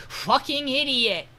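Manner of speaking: mock-exasperated insult to a useless partner. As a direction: scoff at the idiot, drawn out. [name] exasperated at Wignall.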